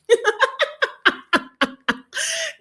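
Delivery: long, high-pitched laughing fit; real laughter. Real, not acted. Vlogger amused by own quip about Jamaicans, real laughter. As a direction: burst out laughing at your own joke.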